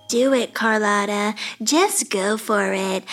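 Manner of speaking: Tiffany. Retold or imitated. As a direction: intone it like a wise spiritual mentor. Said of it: "She'd say".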